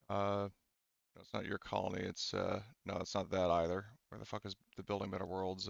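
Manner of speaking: ordinary delivery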